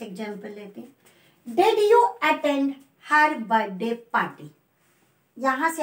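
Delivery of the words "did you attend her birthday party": slow, with "attend", "birthday" and "party" each given weight; teacher's slow model with stress on "attend", "birthday", "party"; slow stressed reading. Shown as a demonstration. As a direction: read it slowly, leaning on "attend", "birthday", "party". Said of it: "attend पे stress है".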